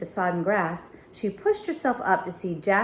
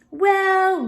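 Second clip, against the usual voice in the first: mocking well; 12 semitones higher, 7 dB louder than usual. A narrator's reading. Villain sneer.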